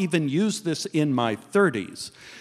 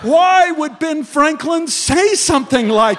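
exasperated shout; 10 semitones higher, 6 dB louder, slower than usual. Real, not acted. Teller's exasperated question.

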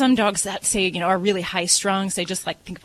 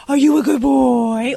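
put-on louder, slower baby-talk question to a pet; 3 semitones higher, 7 dB louder than usual. Shown as a demonstration. Vet demonstrating baby talk for a dog, a question. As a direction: ask it sweetly like to a puppy. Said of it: "You're gonna ask".

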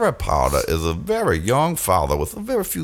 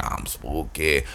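low slow spooky ghost voice; 7 semitones lower, 8 dB quieter, slower than usual. Retold or imitated. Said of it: "scary lady was like".

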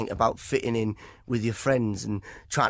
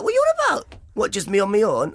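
high, loud incredulous retort; mock incredulity; 9 semitones higher, 4 dB louder than usual. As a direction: act out baffled indignation, pitched up and louder. Retelling his indignant reply from routine.

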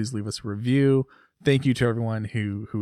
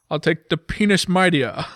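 loud, higher parody of a Scottish contestant; Scottish film-star impression, booming game-show pick; Sean Connery; 7 semitones higher, 7 dB louder than usual. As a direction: say "penis" as "peenish", hammed up. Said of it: "It's the Jeopardy parody".

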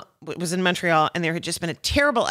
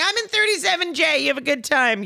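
put-on much higher, sweet little-kid voice; mock toddler voice; 9 semitones higher than usual. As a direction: chirp it like a tiny first-class toddler. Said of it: "You're like".

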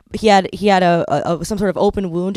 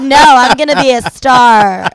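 loud, slow, stubborn declaration; mock defiance; 5 semitones higher, 7 dB louder than usual. Daughter refusing her parents' offer of home, mock defiance. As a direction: stretch it out like a stubborn dreamer.

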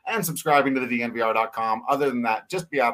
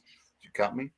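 a hint of a quiet hulking threat; lightly menacing big slugger; 7 dB quieter than usual. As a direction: lean in, soft and ominous. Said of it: "showing up and saying".

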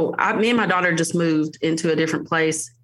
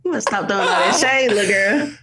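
laughing scold. Retold or imitated. Mom to sassy toddler, laughing scolding. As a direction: scold the toddler while cracking up. Ends roast of daughter.

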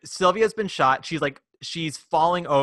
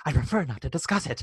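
mock noir Sylvia; faster than usual. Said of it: "and she goes".

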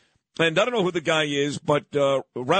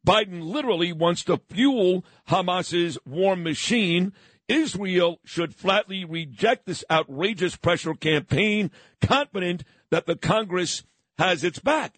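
ordinary talk

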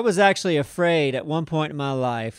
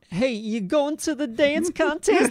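much higher, slow, laughing put-on voice; goofy put-on voice; 12 semitones higher, much slower than usual. Teasing his new friend Simon, a high, slow goofy put-on voice. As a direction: stretch it out high like a silly character. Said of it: "I used to always say something like".

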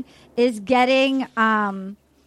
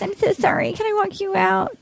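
higher, sorry-sounding mock voice; recapping the breakup scene in a higher voice; high apologetic imitation; 8 semitones higher than usual. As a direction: play the apologetic bride, pitched up. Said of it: "And then she goes".

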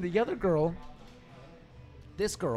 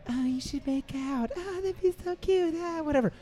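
put-on gushing girlfriend; 12 semitones higher than usual. Tipsy blonde friend egging on a make-out, mock gushing. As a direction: mimic a tipsy girl squealing her approval.

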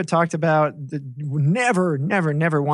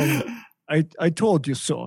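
slow, badly faked Russian accent; mock Russian accent, drawn out; slower than usual. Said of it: "that's a terrible Russian accent".

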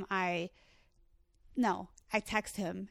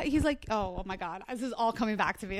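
ordinary talk

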